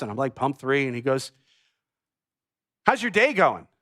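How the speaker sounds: ordinary delivery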